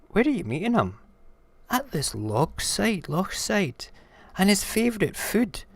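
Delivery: high, animated character voice; high-pitched storytelling dialogue; storybook creatures talking. Acted. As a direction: play it high and eager. Snake and mouse in acted exchange.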